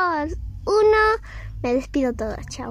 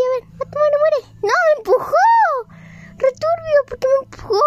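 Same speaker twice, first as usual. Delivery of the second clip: high excited yelling; 10 semitones higher than usual. Real, not acted. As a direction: yell at the screen in a rush. Chasing, then pushed in game.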